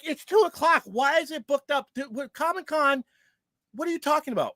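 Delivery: retold incredulous complaint. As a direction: pitch it up like you can't believe what you're hearing.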